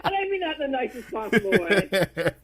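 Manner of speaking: a cheeky jab, laughing through it. Real, not acted. Guest joking about Saraya, laughing through the words.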